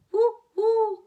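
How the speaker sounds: high, loud wind howl. A narrator's reading. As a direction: moan it like the wind in the trees. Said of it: "the wind blew in the trees".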